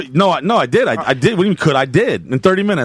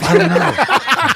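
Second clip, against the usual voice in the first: mock panic; 8 semitones higher, much slower than usual. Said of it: "And I'm thinking".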